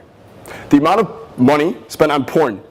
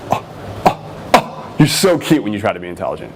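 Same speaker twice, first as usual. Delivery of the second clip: playful tease; 4 semitones lower than usual. Shown as a demonstration. Coach shows the playful way to say it, teasing. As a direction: coo at her playfully. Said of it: "I said it playfully".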